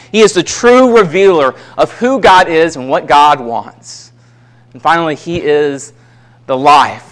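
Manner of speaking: normal talking voice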